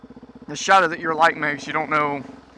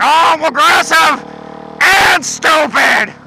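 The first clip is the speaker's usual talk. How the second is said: slow, loud, dopey mock voice; dumb aggressive rider; 9 semitones higher, 5 dB louder, much slower than usual. Mocking aggressive riders, a loud dopey drawl. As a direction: play a proud, dim road hog. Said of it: "They're just like".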